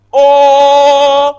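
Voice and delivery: long, loud, held round "O"; tongue-down O. Shown as a demonstration. Teacher showing how to pronounce O, loud and sustained. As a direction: sustain "O" high and loud, tongue lying flat. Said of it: "on couche la langue".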